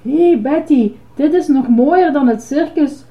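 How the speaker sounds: ordinary delivery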